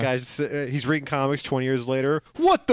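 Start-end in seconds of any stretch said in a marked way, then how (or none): 2.4-2.7 much higher and louder, a squeaky what the; sudden comic outburst; shocked exclamation. Pretend. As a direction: blurt it out in mock disbelief. Mock outrage at fan's fate.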